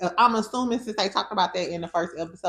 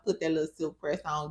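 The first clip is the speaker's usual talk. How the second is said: normal talking voice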